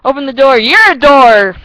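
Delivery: loud high catchphrase, laughing after; shouting the running joke; mock shout. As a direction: yell the old joke with glee. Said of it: "I'm like".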